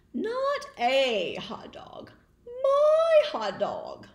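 possessive pigeon. Acted. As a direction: grab it back with your voice. Pigeon claims the hot dog.

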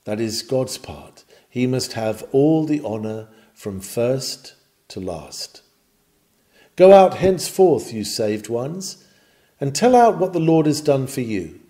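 normal talking voice

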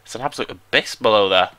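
usual speaking voice

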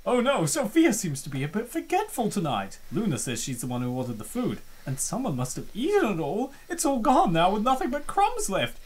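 ordinary talk, nothing marked

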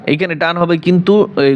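usual speaking voice

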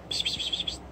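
soft unvoiced psst calling; whispered psst calls. Real, not acted. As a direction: hiss psst to call the pet.

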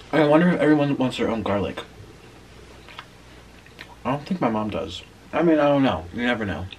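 chewing while talking; muffled by food in mouth; mouth full of pizza. Real, not acted. Seen 0: Bites pizza at -2, cheeks full and chewing throughout labelled span.

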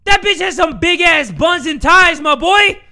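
very loud, high, stunned shrieks; shocked screaming. Real, not acted. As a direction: yell in shock at the monster's size. Screaming at huge monster.

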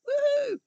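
a high, loud celebratory woo; high woo cheer. Real, not acted. Reader cheering as the chapter ends, high happy whoop. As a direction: let out a high whoop of relief.

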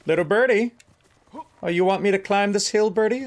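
high, playful voice for a little bird; talking to a birdie. Pretend. Player asks little bird about climbing the hill, high and playful. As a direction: coo up high at a pet bird.

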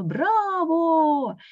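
high shouted bravo; cheering shout. A narrator's reading. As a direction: cheer from the front row. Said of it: "Квак закричал".

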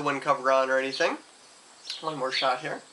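muffled behind fingers; speaking through hand. Real, not acted. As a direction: hand over mouth. Seen 0: From 0.6s his hand covers mouth and nose while speaking.

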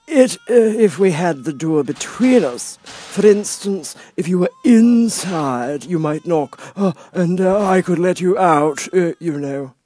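high, slow, lofty footman voice; frog footman. Acted. Frog footman dismissing Alice at the door, lofty. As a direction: talk down to her from on high.

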